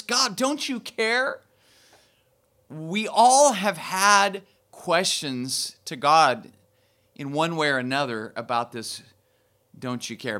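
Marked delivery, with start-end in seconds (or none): 0.0-1.4 high, slow, pleading question rising at the end; anguished plea. Retold or imitated. Voicing anguished prayer to God. 9.8-10.4 low, soft "Don't you care?" falling away; quiet echo. Retold or imitated. Preacher restating the question quietly.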